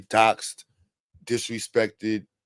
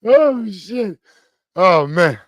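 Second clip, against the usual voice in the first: a high, loud burst of disbelief; excited shock; 9 semitones higher, 6 dB louder, much slower than usual. Real, not acted. Streamer reacting loudly to the clip on screen. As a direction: blurt it out, caught off guard.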